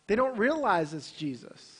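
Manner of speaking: normal talking voice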